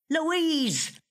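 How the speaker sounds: husband's distant call across the house. A narrator's reading. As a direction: shout her name from another room.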